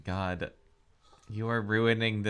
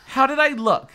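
eager; 14 semitones higher, 10 dB louder than usual. Acted. Heroine fishing for compliment.

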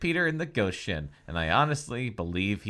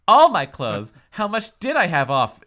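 shocked; 7 semitones higher, 11 dB louder than usual. Acted. Heroine shocked at ghost.

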